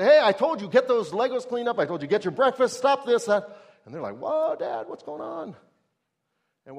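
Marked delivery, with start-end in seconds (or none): 0.0-3.5 put-on loud, high, exasperated yelling at the kids; angry yelling dad. Dad yelling at his kids to clean up, loud and high. 4.2-5.5 put-on high, surprised child voice; startled kids. Kids reacting to their shouting dad, high and startled.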